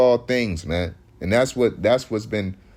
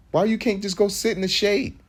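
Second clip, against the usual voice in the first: ordinary delivery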